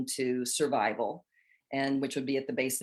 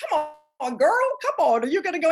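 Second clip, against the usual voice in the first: high, louder cheering urge; coaxing guides; 13 semitones higher, 7 dB louder, faster than usual. Retold or imitated. Guides pulling Jennifer by the elbow, high coaxing. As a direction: egg her on like a cheerleader. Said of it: "your guides ... going".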